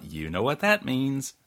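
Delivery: ordinary delivery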